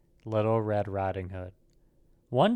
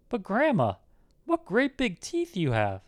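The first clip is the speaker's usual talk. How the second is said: put-on high girlish voice, a touch of alarm; frightened little girl; 8 semitones higher than usual. A narrator's reading. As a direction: marvel with growing unease, pitched high. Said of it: "who was beginning to get frightened".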